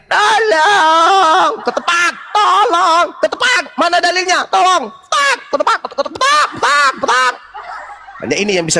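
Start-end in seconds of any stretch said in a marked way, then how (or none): 0.1-7.3 high, wailing squeal of help; mock screaming for help. Debater mocking rivals who only scream for help. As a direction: cry out like a panicking tied-up thief. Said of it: "hanya bisa teriak".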